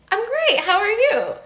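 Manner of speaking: very high, bright-eyed mock cheer; overeager greeting. Nervous traveler answering the agent, high overeager greeting. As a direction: overdo the friendliness in a high voice.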